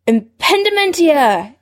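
high, drawn-out incantation; called-out spell. A narrator's reading. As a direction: cast it with a high, stretched cry. Duelling spell cast at Snape.

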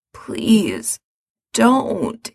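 hoarsely. Acted. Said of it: "he says hoarsely".

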